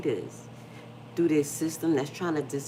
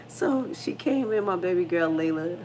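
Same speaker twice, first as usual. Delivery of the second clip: ordinary delivery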